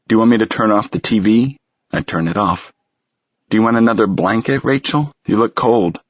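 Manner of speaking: fast, anxious fussing; nervous rush. Acted. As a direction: rattle it off nervously. Nervous husband rattling off offers.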